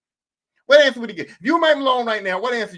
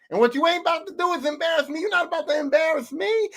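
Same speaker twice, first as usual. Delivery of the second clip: raised-pitch mock dressing-down; mad team owner; 3 semitones higher than usual. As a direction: rant like a boss who feels embarrassed. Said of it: "I'm so mad".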